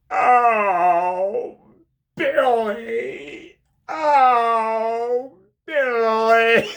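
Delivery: high, loud moaning cry of "Oh, Billy!"; Jim Carrey moaning. Retold or imitated. Jim Carrey through the prison glass, loud, high moaning. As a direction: ham it up like a lovesick inmate. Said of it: "he starts going".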